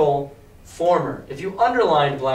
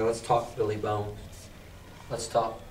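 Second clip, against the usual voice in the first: low sly invitation; 6 semitones lower, 9 dB quieter than usual. Retold or imitated. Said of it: "and I was like".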